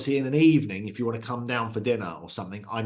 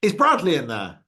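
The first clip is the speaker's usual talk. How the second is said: calling out to a school, louder and higher; mock yell across the playground; retold shout; 5 semitones higher, 6 dB louder than usual. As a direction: call the kid's name out. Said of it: "She shouts".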